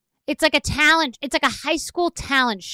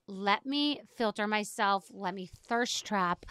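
softer, slow, coy poser voice; mock Instagram poser; 2 semitones lower, 11 dB quieter, slower than usual. Comedian mocking Instagram posters, soft and slow. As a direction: purr it like a vain selfie poster.

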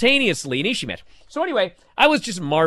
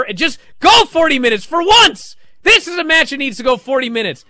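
loud, high indignant outburst; worked-up fan venting, loud and emphatic; appalled; 6 semitones higher, 5 dB louder than usual. Real, not acted. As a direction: protest with outraged disbelief. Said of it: "I was appalled".

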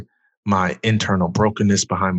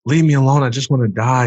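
higher, louder, pushing the words out; desperate yell; 5 semitones higher, 4 dB louder than usual. Retold or imitated. Son yelling at his praying grandma, raw desperate shout. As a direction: yell it like you want everyone gone. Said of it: "I'm just like".

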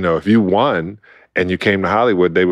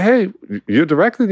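surprised; 12 semitones higher than usual. Retold or imitated. Surprised at the director.

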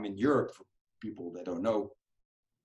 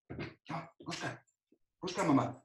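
higher coaxing call to a pet; calling the dog; 8 semitones higher, 4 dB quieter than usual. Real, not acted. Owner calling his dog away, high coaxing call. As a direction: sing out to the dog.

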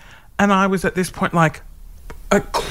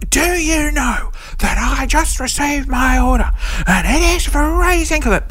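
Karen complaint; 11 semitones higher than usual. Retold or imitated. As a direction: play an outraged Karen writing in. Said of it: "like a Karen".

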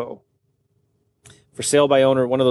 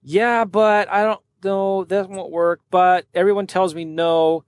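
higher, slow whiny excuse voice; slow, higher whining of a naysayer; mock yeah-butter whine; 4 semitones higher, slower than usual. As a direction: drag out the excuses like a reluctant client. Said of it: "they're yeah butters".

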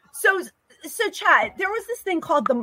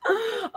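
sharp gasp; 8 semitones higher, 5 dB quieter than usual. Real, not acted. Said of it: "I just started hysterical laughing".

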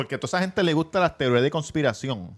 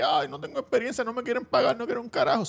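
mock whining; 6 semitones higher than usual. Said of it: "sigue llorando de que".

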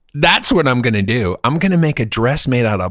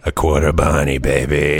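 cool artist voice; 7 semitones lower than usual. Pretend. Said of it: "I'll be the artist".

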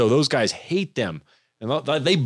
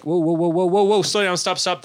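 retold panic; 5 semitones higher than usual. Retold panic at finding a cobra.

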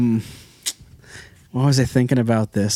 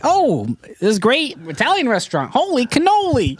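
put-on high, delighted old-lady voice; [name] as old wife; 12 semitones higher than usual. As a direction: gush like an old wife over a restaurant guide. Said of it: "You would say".

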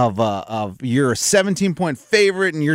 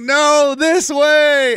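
shouting "No, this way!" high and loud; mock crowd yell; yelled quote; 10 semitones higher, 4 dB louder, much slower than usual. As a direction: call out to redirect the runner. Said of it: "they're all like".

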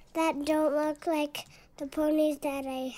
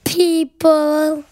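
loud put-on silly monster voice; grumpy guitar voice; 12 dB louder than usual. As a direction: bark it out as a cranky guitar. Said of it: "The guitars eat people".

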